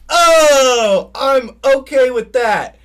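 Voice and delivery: hammed-up oh of relief; relieved exclaim. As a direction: cry out in exaggerated relief. Relieved reaction in story.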